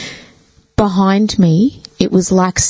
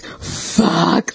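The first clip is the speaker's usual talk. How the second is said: quiet scared curse; 2 semitones lower, 4 dB quieter than usual. Retold or imitated. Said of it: "And I was like".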